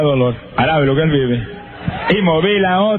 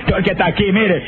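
higher, urgent preacher's exhortation with shouted peaks; preaching at full force; 4 semitones higher than usual. Acted. Preacher exhorts the congregation to buy a Bible, fervently. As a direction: urge the crowd to read the Bible, rising to a cry.

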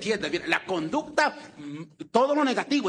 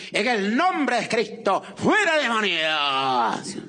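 slow preacher impression, very long drawn-out ending; Ávila; 4 dB louder, much slower than usual. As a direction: cast out demons like the copied preacher, stretching each word. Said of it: "cuando oraba por enfermos". (imitación de exorcista, palabras muy alargadas)

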